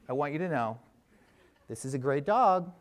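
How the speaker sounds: ordinary delivery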